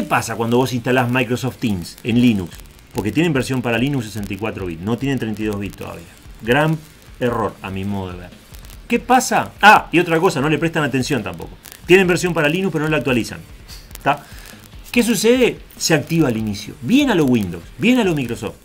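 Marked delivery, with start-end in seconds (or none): none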